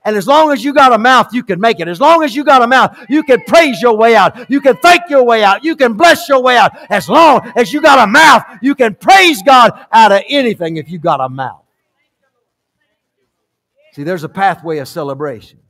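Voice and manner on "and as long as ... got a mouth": loud, driving revival preaching; full-force preaching. Real, not acted. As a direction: hammer each phrase home to the congregation.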